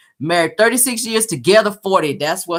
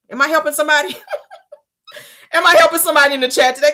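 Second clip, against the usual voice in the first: laughing; 6 semitones higher, much faster than usual. Real, not acted. Laughing at her own advice.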